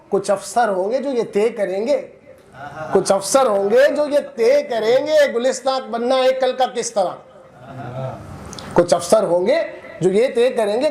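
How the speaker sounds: declaimed verse, lifting on repeated lines; poetry recitation. Acted. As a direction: declaim it to an audience, pausing between phrases. Recites Javed Akhtar's poem.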